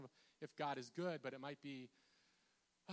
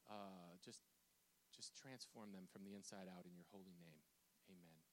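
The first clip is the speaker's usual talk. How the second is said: soft, low praying with hesitant pauses; prayer; 3 semitones lower, 4 dB quieter than usual. Real, not acted. Preacher prays for doubting people in congregation, soft and earnest. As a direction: pray quietly, sinking to a soft amen.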